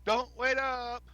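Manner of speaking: shouted cheerful farewell; calling out. Acted. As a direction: yell it over the shoulder. Character calling out leaving.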